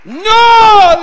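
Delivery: very high, loud shout; Jesus shouting no. Retold or imitated. Jesus refusing the disciples, shouting high and loud. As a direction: cut them off with a cry. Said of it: "les dijo".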